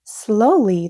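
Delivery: ordinary delivery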